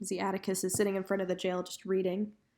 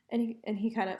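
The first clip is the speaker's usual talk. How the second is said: ordinary delivery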